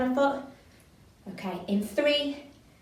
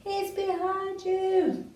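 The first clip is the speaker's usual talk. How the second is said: slow, high sing-song call to the stage; panto audience call; 8 semitones higher, much slower than usual. Shown as a demonstration. Teacher shows the audience's warning cry, high and drawn out. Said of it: "You might be booing, hissing".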